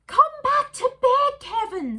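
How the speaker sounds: high, slow coaxing wife; wife's voice. A narrator's reading. Wife calling Kevin back to bed, high and slow. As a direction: call him back to bed.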